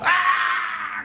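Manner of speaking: high, loud burst of laughter; laughing shout at his own mishap. Real, not acted. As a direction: let the laugh explode.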